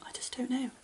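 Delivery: whispered, at a loss; hushed bafflement. Real, not acted. Doing a face for the person filming, whispered bafflement. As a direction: drop to a helpless little whisper.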